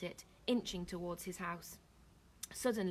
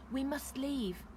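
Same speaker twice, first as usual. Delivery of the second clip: ordinary delivery, nothing marked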